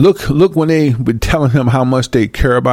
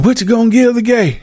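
pastor voice; 12 semitones higher than usual. Retold or imitated. Voicing the pastor collecting money.

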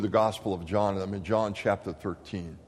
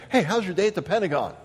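upbeat wife; 7 semitones higher, faster than usual. Retold or imitated. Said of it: "She's just an upbeat person".

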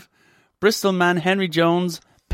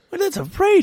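mock eager settler; 13 semitones higher, faster than usual. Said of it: "they went".

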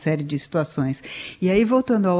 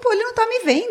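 high, frustrated mock complaint; hurt wife; much faster than usual. Wife complaining that her husband ignores her pain, high frustrated whine. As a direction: vent that he doesn't see you.

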